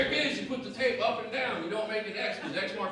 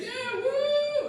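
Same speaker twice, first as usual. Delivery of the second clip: much higher, squealed mock whoop; mock crowd cheer; 4 dB louder than usual. Comic mocking the audience's cheer, high mock whoop. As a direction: ham up a lukewarm audience cheering. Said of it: "You guys was clapping your hands".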